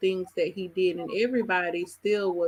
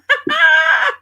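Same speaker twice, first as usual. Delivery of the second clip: shrieking burst of laughter; loud high laugh; 11 dB louder than usual. Real, not acted. Host laughs loudly.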